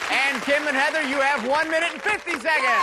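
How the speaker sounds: high, rousing game show call; excited announcing. Real, not acted. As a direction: fire up the teams as you announce. Host announces times to cheering teams.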